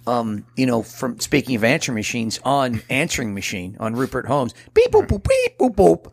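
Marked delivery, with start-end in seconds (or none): 4.7-6.1 high beeping tones, much higher, last boop punched loud; imitated beeps. Host imitating the song's beeping sound, high machine beeps. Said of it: "maybe that sound".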